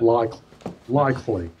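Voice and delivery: LIKELY broken after the K; Australian "likely", rear K; back-of-mouth K. Shown as a demonstration. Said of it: "comes from the back of the mouth more".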